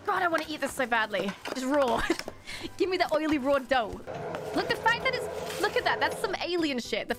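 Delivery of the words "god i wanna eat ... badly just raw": ordinary talk, nothing marked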